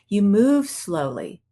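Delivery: slow, unhurried delivery; slowed-down relaxed speech; slow demo. Shown as a demonstration. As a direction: show the calm pace as you speak. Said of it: "you now speak slowly".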